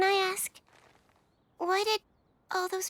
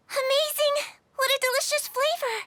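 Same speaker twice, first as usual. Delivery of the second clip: higher and louder, overjoyed at the taste; delighted surprise; 8 semitones higher, 4 dB louder than usual. Acted. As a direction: burst out in amazement at the flavor. Girl tastes the mochi, delighted.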